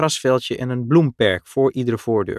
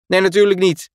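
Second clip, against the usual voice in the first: high, louder protest with forced bravado; called out; 9 semitones higher, 6 dB louder than usual. A narrator's reading. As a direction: call back loudly to hide the fear, much higher. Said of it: "riep Michiel". (luid en hoog, gespeelde moed)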